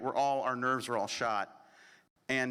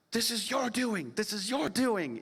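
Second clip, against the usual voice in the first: higher-pitched imitation of an upset mother; hammed-up blame, pitched up; mock accusing; 7 semitones higher than usual. Distraught mother turning on her son, accusing.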